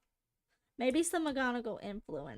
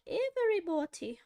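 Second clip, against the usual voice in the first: posh British woman; 6 semitones higher, 4 dB louder than usual. Retold or imitated. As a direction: stretch it out like a prim British lady. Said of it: "I'm like".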